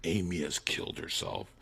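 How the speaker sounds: a low deadpan aside; flat quiet aside. Retold or imitated. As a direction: drop to a low, soft murmur.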